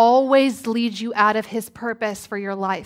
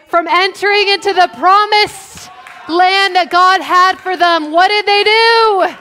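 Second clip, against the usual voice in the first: full-force preaching; 6 semitones higher, 7 dB louder than usual. Real, not acted. Preacher telling why the Israelites missed the land, full-force preaching. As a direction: drive the question and answer home.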